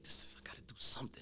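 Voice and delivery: whispered to himself; whispered thought. Retold or imitated. Alone in covid quarantine, whispering his own thoughts.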